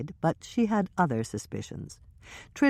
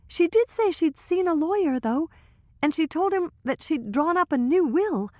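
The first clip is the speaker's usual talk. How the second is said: put-on higher young woman's voice, hushed gossip; Trisha's voice; 12 semitones higher than usual. Trisha confiding to Hannah and Michelle, higher nervous voice.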